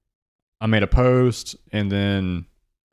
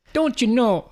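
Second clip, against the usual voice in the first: high-pitched mock Canadian "Don't you know?"; lilting Canadian accent, pitched way up; 14 semitones higher, slower than usual. Band guys mocking Canadian tourists, high Canadian accent. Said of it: "their accent was like".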